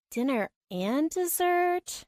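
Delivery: slow, high, playful question; teasing surprise. A narrator's reading. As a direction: stretch it out, amused.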